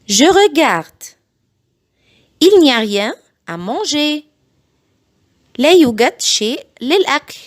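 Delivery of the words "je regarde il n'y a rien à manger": very slow, each syllable spelled out; slow it right down so parents can copy; slow model sentence for learners; slow teaching read. Shown as a demonstration. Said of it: "بعيد الجمل بالراحة جدًا".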